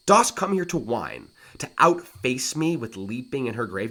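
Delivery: mocking rival's challenge; taunting challenge. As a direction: taunt the grieving brother. Hamlet taunts Laertes.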